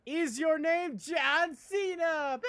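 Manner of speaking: high, loud, drawn-out announcer yell; mock hype shout. Streamer shouting a meme name, mock hype yell.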